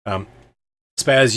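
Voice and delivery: normal talking voice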